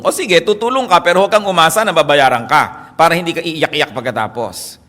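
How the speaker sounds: usual speaking voice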